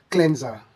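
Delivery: "cleanser" as klen-za, short e; correct cleanser. Shown as a demonstration. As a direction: say "cleanser" with a z sound. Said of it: "making it smoother to say".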